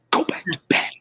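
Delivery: hushed, urgent whisper; whisper yelling. Retold or imitated. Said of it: "the whisper yell".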